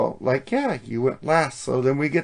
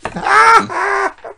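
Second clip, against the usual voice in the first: high laugh. Real, not acted. Laughing at the bit.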